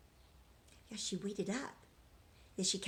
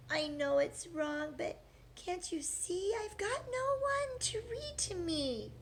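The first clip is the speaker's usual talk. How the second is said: softly crying; 8 semitones higher than usual. Acted. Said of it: "softly he began to cry".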